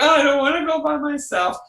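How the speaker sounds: put-on very high, fast little-kid whine; child protesting going to school alone; whiny child. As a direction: whimper like a reluctant child.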